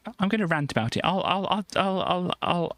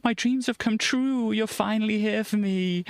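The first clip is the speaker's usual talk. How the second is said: dreamy Eponine; 6 semitones higher than usual. Retold or imitated. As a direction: sigh it out like a lovestruck girl. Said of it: "she's like".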